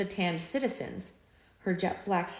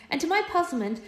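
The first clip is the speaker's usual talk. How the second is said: plain reading voice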